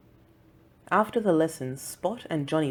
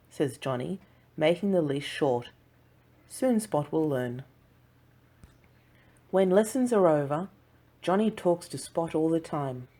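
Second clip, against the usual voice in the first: plain reading voice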